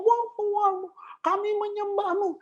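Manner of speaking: high, hammed-up mock praise; mocking fake worshipper. Preacher mocking a worship leader's empty praise, high mock voice. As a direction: parody a hollow worship leader's cry. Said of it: "Itu kamu bukan menyembah, kamu laporan".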